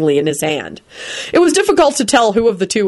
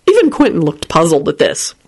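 plain reading voice

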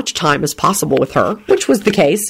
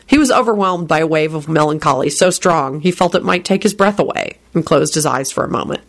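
ordinary delivery

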